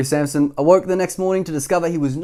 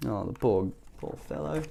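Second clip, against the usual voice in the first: tender pity; 3 semitones lower, 10 dB quieter than usual. Real, not acted. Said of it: "narrator's tender aside".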